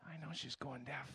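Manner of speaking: very soft grumble; under-the-breath aside; muttered. Retold or imitated. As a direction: mutter it to yourself.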